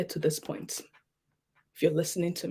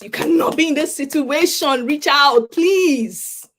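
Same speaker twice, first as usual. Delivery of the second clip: louder, higher pleading; urgent plea; 6 semitones higher, 6 dB louder, slower than usual. Real, not acted. Telling viewers in crisis to reach out, urgently pleading. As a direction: beg the listener to reach out.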